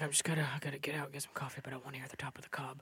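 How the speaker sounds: fast, hushed whisper. Pretend. Listener in a freezing truck murmuring, fast whisper. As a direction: whisper it quickly to yourself. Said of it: "You're like".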